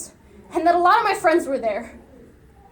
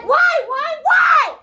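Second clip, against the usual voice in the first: higher, louder anguished screaming; 12 semitones higher, 5 dB louder, much slower than usual. Acted. Son screaming at his dead mother, anguished scream. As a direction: scream it at the top of your lungs.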